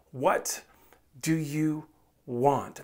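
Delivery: quiet and drawn out, word by word; soft slow question. Acted. Preacher asking the congregation, soft and slow. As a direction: let each word hang softly.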